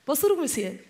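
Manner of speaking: imitated Malian greeting with a smile; smiling Malian accent. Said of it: "ils sont toujours très souriants".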